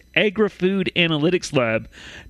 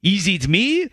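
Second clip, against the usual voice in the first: put-on slow, hammy French Canadian accent; slower than usual. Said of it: "my French accent that I perfected".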